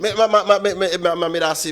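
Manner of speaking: host breaks in loudly to stop the guest talking; loud interruption. Real, not acted. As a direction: call over him to take the floor. Host forcing his way in.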